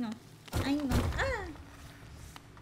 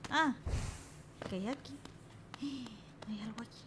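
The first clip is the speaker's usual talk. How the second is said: ordinary talk, nothing marked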